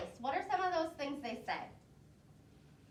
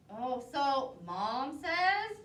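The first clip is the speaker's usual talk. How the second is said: ordinary delivery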